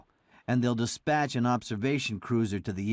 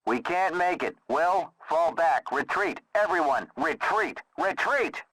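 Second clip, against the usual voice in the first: shouted retreat orders; 4 semitones higher than usual. Acted. Ordering retreat under fire.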